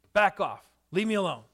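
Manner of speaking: loud, curt keep-away; mock back off. Hurt person telling others to back off, loud and curt. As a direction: push people away. Acting the push-away reply.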